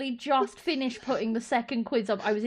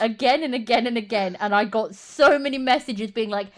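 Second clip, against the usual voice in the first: normal talking voice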